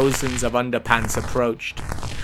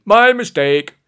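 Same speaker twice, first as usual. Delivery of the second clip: loud, chirpy backing down; cheery apology; 3 semitones higher, 7 dB louder than usual. A narrator's reading. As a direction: bumble cheerfully through an apology. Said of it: "said quite cheerily".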